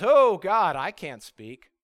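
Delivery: loud, groaning "Oh, God", then dropping off; hammed-up reluctant prophet; reluctant protest. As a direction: balk at the call, acting it out. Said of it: "And Jeremiah says".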